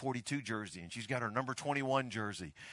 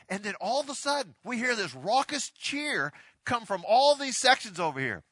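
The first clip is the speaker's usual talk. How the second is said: ordinary talk, nothing marked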